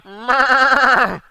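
loud hammed-up moo bellowed upward; mock cow moo. The king as a cow looking up to God, loud mock moo. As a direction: bellow like a cow calling to heaven. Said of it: "he did it as a cow voice".

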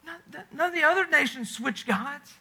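ordinary talk, nothing marked

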